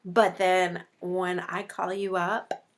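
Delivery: ordinary delivery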